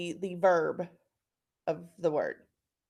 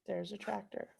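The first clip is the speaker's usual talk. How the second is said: hushed discovery; 12 dB quieter than usual. Pretend. Teacher finds word, hushed.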